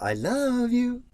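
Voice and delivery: high, slow "I love you" ending up on "you"; sing-song wrong take. Shown as a demonstration. Teacher shows an intonation that doesn't work when spoken, high and slow. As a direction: lift "you" like a tune. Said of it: "That doesn't work in the spoken language".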